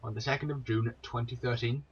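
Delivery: ordinary delivery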